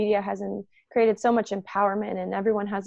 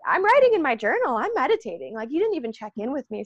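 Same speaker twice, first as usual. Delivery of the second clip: higher, affronted mock protest; indignant friend; 6 semitones higher than usual. Friend interrupted while journaling, affronted and put out. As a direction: sound put out at being interrupted. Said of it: "they're standing there like".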